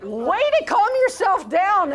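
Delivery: high, loud, laughing encouragement; mock cheerful praise. Parent laughingly praising the teen's huff. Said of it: "and going".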